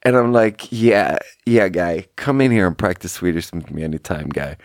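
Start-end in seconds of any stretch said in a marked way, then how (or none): none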